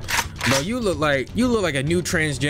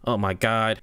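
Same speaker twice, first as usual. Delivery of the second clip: ordinary delivery, nothing marked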